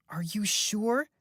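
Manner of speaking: high, slow, anxious questioning; worried daughter. A narrator's reading. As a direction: press him gently, worried. Beth worried on phone.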